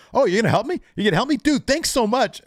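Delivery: high, loud mock gratitude; mocking sarcasm. MJF mocking Strong's offer, high and sarcastic. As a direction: sneer it back, sarcastic. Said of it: "M J F leans over and says".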